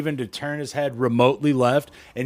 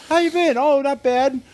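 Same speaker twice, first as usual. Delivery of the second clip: high, quick chirpy pleasantries; breezy small talk; 12 semitones higher than usual. Retold or imitated. Brushing off the manager, high chirpy small talk. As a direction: rattle off the small talk brightly. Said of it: "It's just a few, like".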